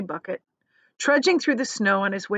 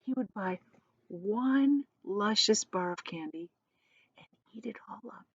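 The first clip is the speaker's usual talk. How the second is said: softer, hurried whisper to himself; quick whisper; 9 dB quieter than usual. A narrator's reading. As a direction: whisper the plan to yourself, eager and hushed. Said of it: "he whispered quickly to himself".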